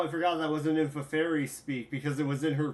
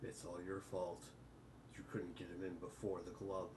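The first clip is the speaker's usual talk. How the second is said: quiet mocking voice; 8 semitones lower, 18 dB quieter than usual. Gamzee-style mocking consoling.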